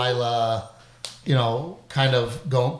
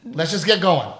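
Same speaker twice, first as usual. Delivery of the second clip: carefree mock retort; 7 semitones higher, 4 dB louder than usual. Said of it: "I'm like".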